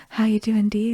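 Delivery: soft whispered check-in; quiet tender question at the bedside; gentle whisper. Retold or imitated. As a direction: whisper close to her ear. Friend kneels beside laboring woman.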